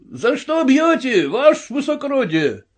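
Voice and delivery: high, plaintive protest; crying out in protest. A narrator's reading. Soldiers objecting to the colonel's blow, crying out. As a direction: call out indignantly from the ranks. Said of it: "послышались возгласы".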